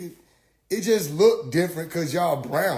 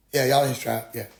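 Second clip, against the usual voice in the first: low, level concession; understated agreement; 5 semitones lower, 4 dB quieter than usual. Retold or imitated. Questioner humouring the preacher about his tribe, low and level. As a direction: grant it low and unbothered.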